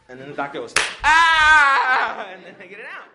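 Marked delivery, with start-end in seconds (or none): 1.0-2.3 put-on very loud, high baby wail; sudden full-volume mock scream; imitated newborn cry. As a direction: wail like a newborn just slapped.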